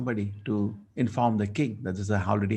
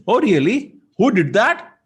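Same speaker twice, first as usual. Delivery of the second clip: much higher and louder, alarmed; a son stunned that his father was insulted; shocked; 11 semitones higher, 9 dB louder than usual. Acted. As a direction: jolt up in outrage at the news.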